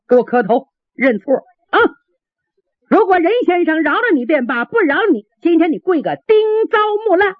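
high-pitched scolding command; angry order. A narrator's reading. Gate officer berating soldier before offended gentleman, high angry order. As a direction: lay down the punishment, climbing high and speeding up.

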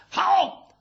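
high-pitched cheer; 喝彩吼叫. A narrator's reading. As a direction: shout "bravo" like an onlooker. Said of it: "跟着吼". (高声喝彩)

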